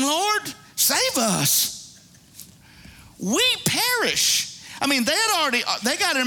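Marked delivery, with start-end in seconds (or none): 0.0-4.6 high, dramatised cry for rescue; desperate plea. Acted. Disciples waking Jesus in the storm, acted desperate plea. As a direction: plead high, stretching out the last words. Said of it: "The disciples came to him and woke him up".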